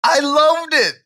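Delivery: high, loud gleeful enthusiasm; excited. Real, not acted. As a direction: gush about it, thrilled. Arguing he loved it.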